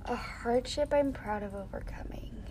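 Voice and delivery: soft unvoiced whisper, musing over the question; whispered to herself. Real, not acted. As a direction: whisper the question back to yourself while thinking. Repeats question to herself thinking.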